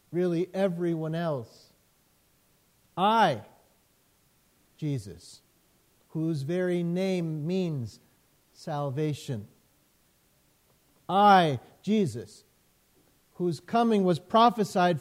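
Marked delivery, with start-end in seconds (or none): 3.0-3.4 "I" punched loud and held alone; emphatic I. Shown as a demonstration. Preacher stressing "I" to the congregation, loud and emphatic. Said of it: "It emphasizes the "I". 11.1-11.6 "I" said much louder, set apart by a pause; emphatic I. Shown as a demonstration. Preacher stressing "I" to the congregation, loud and emphatic. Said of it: "It emphasizes the "I".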